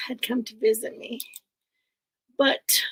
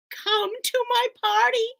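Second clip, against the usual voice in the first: witch voice; 9 semitones higher than usual. Acted. Witch reads invitation.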